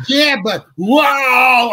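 high, loud, stretched cry, half laughing; retold yell into a fall. Actor falling off the mound in the scene, crying out. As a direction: yell as you fall into the hole. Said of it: "And I yell".

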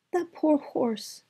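high, slow, pitying princess; cartoon princess. A narrator's reading. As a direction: sigh it out like a tender princess. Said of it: "said the princess".

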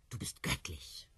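whispered tender quote. Acted. Woman whispering her husband's praise. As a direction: breathe it like a lover.

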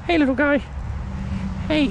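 high, cooing call to a small critter; sweet sing-song hello to a wild animal; coaxing high voice. Real, not acted. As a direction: coax him like you would a pet.

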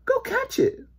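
very high urgent command; urgent mother. A narrator's reading. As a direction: send him off in an alarmed high voice. Mother urges boy to chase.

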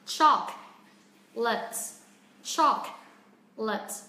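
"Chocolate" slowly split, strong CHOC then weaker "let"; slow two-beat "Chocolate" stressed on CHOC; first-syllable stress. Shown as a demonstration. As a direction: punch and lengthen CHOC, pause, light "let". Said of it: "phải phát âm cao hơn".

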